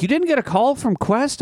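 high mock-surprised question; imitating HR lady. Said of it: "my H R lady was like".

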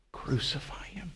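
breathy hushed whisper of Crucify him; whispered crowd cry. Retold or imitated. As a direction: start the crowd's chant as a whisper. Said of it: "what did they cry out".

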